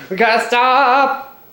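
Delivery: high, slow, loud drawn-out complaint; mock-exasperated wail. Real, not acted. Vlogger interrupted again, mock-exasperated drawn-out wail.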